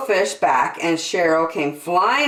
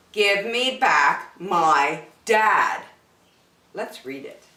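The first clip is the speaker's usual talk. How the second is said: usual speaking voice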